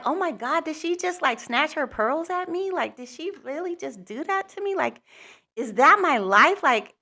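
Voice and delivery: high, rising panicky disbelief; shocked panic with the voice pitched high; retold freak-out. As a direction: replay the moment you started freaking out. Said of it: "I started freaking out".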